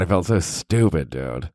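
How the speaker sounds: ordinary talk, nothing marked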